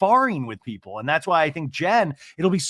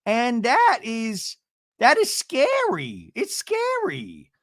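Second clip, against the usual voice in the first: very high, slow, drawn-out mimicry; mock dramatic warning, climbing higher; imitated castmate confrontation; 8 semitones higher, much slower than usual. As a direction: stretch it out in a high put-on voice. Acts out castmate confronting Tamra.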